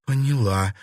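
constrained, pained reply; quick start, then a choked halt; strained voice. A narrator's reading. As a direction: squeeze the words out through pain. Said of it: "сдавленным голосом произнесла Катерина".

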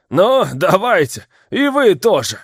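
put-on high, loud drunken shout; yelling. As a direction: egg everyone on at full yell. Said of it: "орал он остальным".

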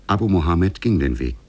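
ordinary talk

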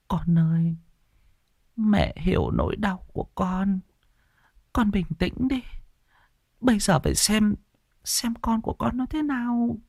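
put-on high, hushed old woman's voice; old mother comforting. Mother quietly consoling her son, hushed and high. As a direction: soothe him softly in a frail high voice.

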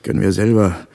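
loud, sarcastic jab back; mocking retort. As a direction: throw his words back at him. Quarrel over the compass needle.

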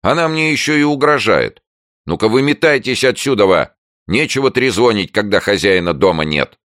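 high, loud scolding tirade; outraged neighbour. A narrator's reading. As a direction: chase them off indignantly. (возмущённая ругань соседки)

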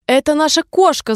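put-on lightly boastful little girl; proud. A narrator's reading. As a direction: show off a beloved pet. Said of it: "с гордостью ответила Алиса".

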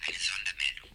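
ordinary talk

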